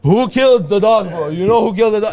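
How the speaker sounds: loud, high accusing questions; mock interrogation. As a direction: grill the villagers in mock outrage. Retold interrogation of the village.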